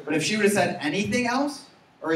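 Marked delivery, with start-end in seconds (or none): none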